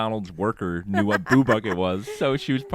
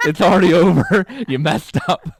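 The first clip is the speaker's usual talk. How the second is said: laughing doom; 7 semitones higher, 8 dB louder than usual. Retold or imitated. Inner voice watching order fail.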